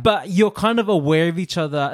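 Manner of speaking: ordinary delivery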